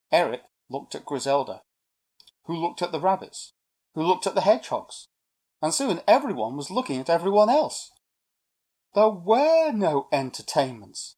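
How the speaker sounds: usual speaking voice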